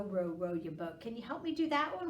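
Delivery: usual speaking voice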